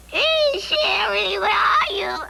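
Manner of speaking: high, drawn-out call. Acted. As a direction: sing out to someone out of sight. Calling out to find Sherry.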